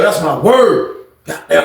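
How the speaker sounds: normal talking voice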